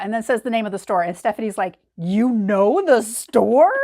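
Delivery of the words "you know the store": drawn-out, louder, amazed question; very slow disbelief, as if you can't believe it; mock-astonished, slowed right down. Stephanie stunned they know store.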